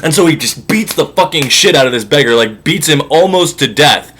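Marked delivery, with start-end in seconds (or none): none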